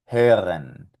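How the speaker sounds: "Hören" with a rounded ö; German ö. Shown as a demonstration. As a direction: model "Hören" carefully. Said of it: "con esta técnica".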